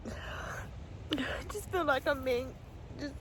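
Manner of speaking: slow, breathy whisper through tears; barely getting the words out while crying. Real, not acted. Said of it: "I'm doing the proper ugly cry now".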